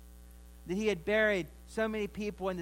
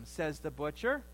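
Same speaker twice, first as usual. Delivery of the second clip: ordinary talk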